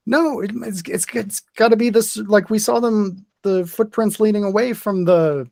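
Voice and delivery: high-pitched character voice, arguing her point; Regina. Acted. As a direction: pitch it well up, insisting on staying to help. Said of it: "Regina doesn't want to leave".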